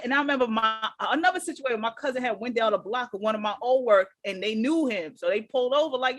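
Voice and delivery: ordinary talk, nothing marked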